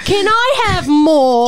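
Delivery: put-on high, slow, pleading English orphan accent; British accent. Host joining the accent bit, pleading British orphan. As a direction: beg in hammed-up British. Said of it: "do your British".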